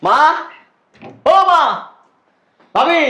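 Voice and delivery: loud, high call across the house. Acted. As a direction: shout for mother from the door. Hungry son calling mother indoors. (দূর থেকে জোরে ডাকা)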